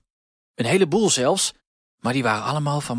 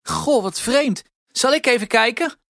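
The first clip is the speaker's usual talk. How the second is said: high Huispiet voice; 12 semitones higher, 5 dB louder than usual. A narrator's reading. Huispiet offers Sinterklaas help, high and wondering. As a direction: pitch way up, a bit louder.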